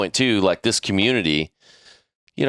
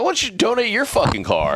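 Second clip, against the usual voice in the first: outraged retort; 13 semitones higher than usual. Retold or imitated. Heated argument with HOA lady.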